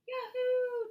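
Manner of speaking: a high, loud whoop of delight; joyful yell. A narrator's reading. Charlie yelling as the kite climbs, high loud whoop. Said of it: "Charlie yelled".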